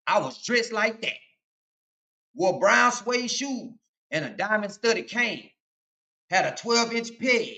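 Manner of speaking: rhythmic street poem delivery; toast recitation. Acted. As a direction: perform it like an old toast.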